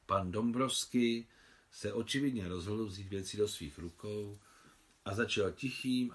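plain reading voice

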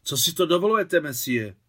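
high, loud indignant challenge. Acted. Maria confronting the taxi driver who blocked her, indignant. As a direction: confront the stranger sharply. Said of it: "s pobouřením".

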